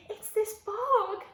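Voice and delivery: howling dog. A narrator's reading. Dog stuck in the bog, slow whining complaint. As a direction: whine it out. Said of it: "said the dog".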